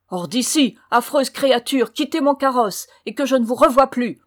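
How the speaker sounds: loud, forceful banishing; outraged cry. A narrator's reading. Horrified prince banishing the false bride, angry exclaiming. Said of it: "s'écria-t-il".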